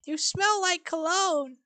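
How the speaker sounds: slow, high frail mother; a lightly voiced elderly mom; high old mother voice. Penny voiced in read script.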